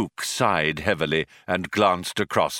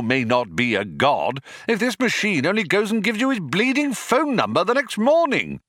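higher-pitched, aggrieved shop steward tirade; indignant union man; 8 semitones higher than usual. Acted. Philosopher argues machines threaten his job, indignant union man. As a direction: rant like a worker defending the job.

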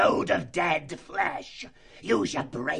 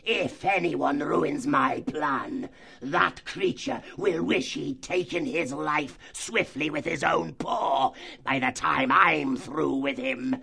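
higher, slow, shouted threat; threatening fox chief; 8 semitones higher, slower than usual. Acted. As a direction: menace the whole band.

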